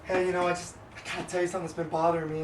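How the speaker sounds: low, soft, troubled confession; feigned sadness. Acted. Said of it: "look all kinda sad".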